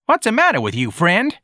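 put-on high, needling boy's voice; brash street kid. A narrator's reading. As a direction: challenge him like a cocky youngster. Voicing George, challenging.

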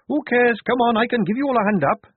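high, loud dismissal; Tonka's brash voice. A narrator's reading. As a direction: call down high and briskly. Tonka calls down brusquely.

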